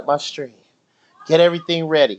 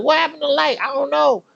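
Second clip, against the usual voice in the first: high puzzled whine; retold bafflement, pitched high; confused; 12 semitones higher, faster than usual. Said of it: "I was like".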